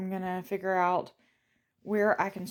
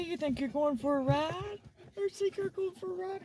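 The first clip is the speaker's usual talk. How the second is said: high, softer teasing question; teasing pet talk; 9 semitones higher, 5 dB quieter than usual. Real, not acted. Asking Daisy if she thinks she's going for a ride, high teasing coo. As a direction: tease the dog playfully up high.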